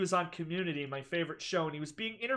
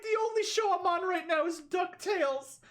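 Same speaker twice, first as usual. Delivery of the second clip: squeaky, much higher mock quote; high-pitched retelling; 10 semitones higher than usual. Streamer quoting the actor to Larry King, high-pitched. Cracking up retelling the interview clip.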